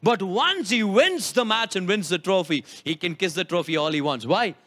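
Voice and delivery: ordinary talk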